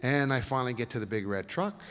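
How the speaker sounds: ordinary talk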